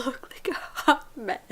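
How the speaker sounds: hushed whisper; breathy whispered aside. Real, not acted.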